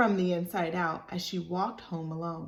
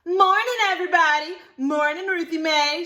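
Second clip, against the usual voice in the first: much higher, louder sing-song hellos; nice child greeting; 10 semitones higher, 9 dB louder than usual. Acted. Libby greeting friends at church, bright and high. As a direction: skip in and greet everyone sweetly. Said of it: "she spoke all nice".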